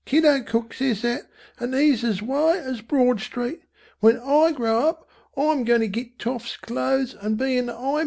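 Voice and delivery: put-on high-pitched young Cockney boy; boastful boy.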